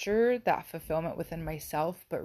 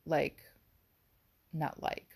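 ordinary delivery

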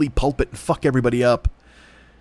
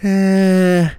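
dismissive eh; 6 semitones higher, 6 dB louder than usual. Retold or imitated. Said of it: "And he's like".

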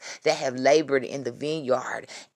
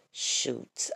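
ordinary talk

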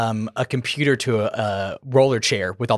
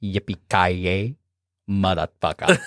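deep slow catchphrase; 5 semitones lower, slower than usual. Retold or imitated. Mocking the hero's catchphrase back at him, low and slow. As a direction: lower and slow, a mock villain growl. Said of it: "What is it that you told me earlier?".

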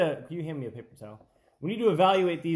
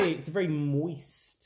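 put-on British accent; slower than usual. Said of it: "I don't know how to do an accent".

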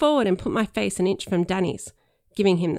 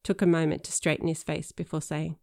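ordinary delivery

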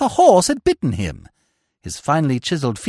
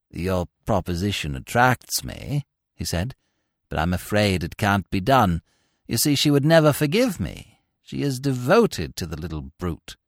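lightly voiced long-suffering suitor; weary lovesick Bingo; 10 semitones lower than usual. A narrator's reading. Bingo voiced throughout scene.

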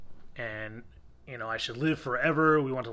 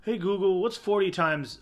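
higher, louder mock call to Google; mocking imitation; 6 semitones higher, 5 dB louder than usual. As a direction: ham up a clueless man asking his phone. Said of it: "but he goes".

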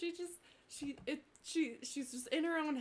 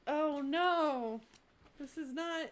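dismayed; 4 semitones higher, 6 dB louder than usual. Acted. Character dismayed at no chairs.

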